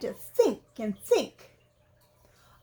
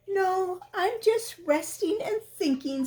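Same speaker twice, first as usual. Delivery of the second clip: higher, pretending all is fine; innocent Pooh; 8 semitones higher than usual. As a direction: fib cheerfully. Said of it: "said Pooh".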